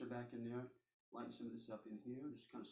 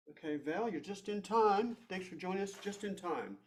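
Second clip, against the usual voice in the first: loud, higher and slow, a glad welcome; excited greeting; 7 semitones higher, 10 dB louder, slower than usual. Real, not acted. Teacher welcoming Val who arrives late, loud cheerful greeting. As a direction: call out a happy hello to the latecomer.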